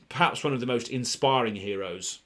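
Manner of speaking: ordinary delivery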